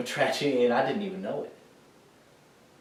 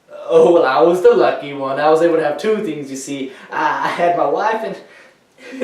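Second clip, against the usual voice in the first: loud, fervent outburst of feeling; emphatic gratitude; 5 semitones higher, 9 dB louder than usual. Acted. Veteran telling of his wife and son, loud and fervent. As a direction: proclaim it loudly, clinging to your good luck.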